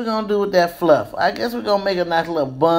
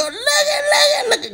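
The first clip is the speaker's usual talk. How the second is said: excited squeal. Real, not acted. Admiring her own hair.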